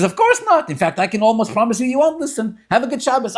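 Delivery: high, loud teasing brush-off; acted rebbe cheerfully ending the argument; emphatic dismissal.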